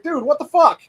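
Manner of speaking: high startled what the fuck; shocked outburst. Retold or imitated. Spotting strangers in the yard, a shocked outburst. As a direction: yelp it out in disbelief.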